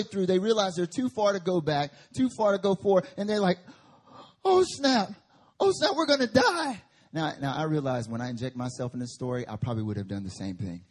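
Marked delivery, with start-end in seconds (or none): 4.4-6.8 high, loud mock panic; panicked disciples. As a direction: yelp in mock alarm.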